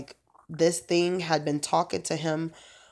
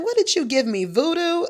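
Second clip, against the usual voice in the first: high, loud astonished question; amazed; 11 semitones higher, 6 dB louder than usual. Retold or imitated. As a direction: ask it in disbelief, higher. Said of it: "He was like".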